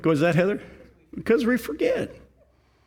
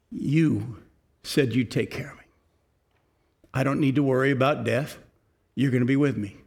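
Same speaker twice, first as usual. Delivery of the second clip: sincere prayer; 4 semitones lower than usual. Retold or imitated. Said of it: "until you can honestly say".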